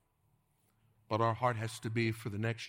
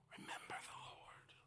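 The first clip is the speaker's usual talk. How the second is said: breathy hushed whisper; 17 dB quieter than usual. Acted. Preacher whispers to congregation to remember the Lord. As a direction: drop to a hush. Sudden whisper after cry.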